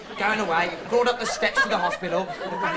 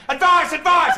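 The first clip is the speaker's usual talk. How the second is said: shouted higher and louder; mock drowning shout; 8 semitones higher, 7 dB louder than usual. Drowning swimmer calling out, mock shout. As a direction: yell it like a drowning man.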